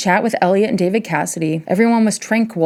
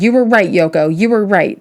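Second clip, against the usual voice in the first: narrated shout; 3 dB louder than usual. A narrator's reading. Drunk husband yelling down the phone to Yoko, louder. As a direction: punch it out like he's yelling down the phone. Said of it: "he shouted".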